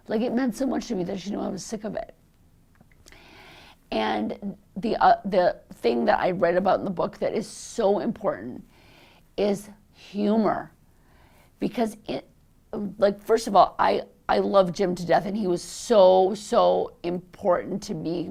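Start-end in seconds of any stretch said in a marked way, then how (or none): none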